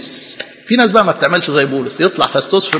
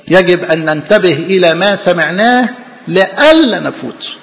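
ordinary talk

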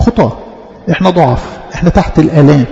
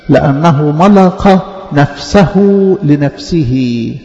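slow verse reading; much slower than usual. Real, not acted. Verse repeated slowly.

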